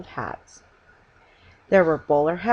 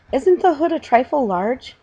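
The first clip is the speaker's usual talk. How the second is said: higher, louder anxious question; 8 semitones higher, 5 dB louder than usual. Acted. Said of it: "asked Missus Brown anxiously".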